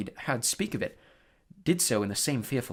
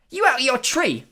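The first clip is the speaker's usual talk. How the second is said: much higher, loud shocked yelp; 12 semitones higher, 9 dB louder than usual. Acted. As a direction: jump at the forbidden name. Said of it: "yelped Stan".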